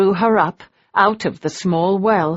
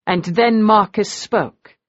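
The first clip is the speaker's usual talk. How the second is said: ordinary delivery, nothing marked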